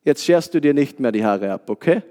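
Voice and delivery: tief angedeuteter Großvater mit fragendem Schluss; ruhig mahnender Opa. Retold or imitated. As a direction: dem Enkel mit gesenkter Stimme ruhig zureden. Opa redet dem Enkel ruhig zu. (ruhig und tief mahnen wie ein Opa)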